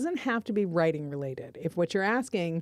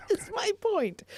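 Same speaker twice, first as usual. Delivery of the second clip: laughing protest; slower than usual. Real, not acted. Exasperated, laughing at the interruption.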